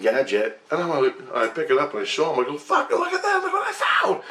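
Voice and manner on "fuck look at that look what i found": very high, strained exclamation; retold astonishment. Digger shows crew the gadget he found, astonished. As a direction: squeal it to show off a find.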